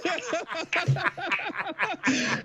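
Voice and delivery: lightly hammed witch laugh; witch cackle. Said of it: "she goes".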